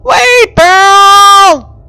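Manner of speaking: high, loud desperate yell. Acted. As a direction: scream after her as she falls. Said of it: "SpongeBob yelled out".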